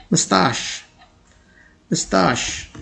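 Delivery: mustache as "mus-tash", said softly twice; correct pronunciation. Shown as a demonstration. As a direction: model mustache for learners. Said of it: "the correct pronunciation is". (mustache का सही उच्चारण)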